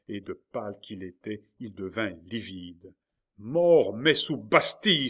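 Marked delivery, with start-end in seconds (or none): 3.4-5.1 loud, high roar of rage; furious oath. A narrator's reading. King taking aim at Henri, furious roared oath. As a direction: bellow it like a curse. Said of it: "s'écria-t-il en mettant le roi de Navarre en joue".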